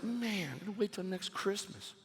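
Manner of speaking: soft, deflated mock sigh of a line; stłumione rozczarowanie. Acting let-down after presents are over.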